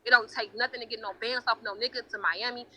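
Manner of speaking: ordinary talk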